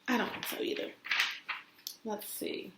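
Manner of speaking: soft whisper; whispered aside. Real, not acted. Teacher whispering an aside to kids. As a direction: whisper it to the class.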